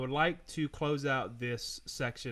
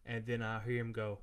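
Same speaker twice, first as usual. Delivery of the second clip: ordinary delivery, nothing marked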